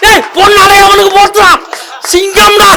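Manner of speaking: high-pitched exclamation of delight; excited cheering. Real, not acted. Moderator applauding a child's answer as a lion's, excited cheering.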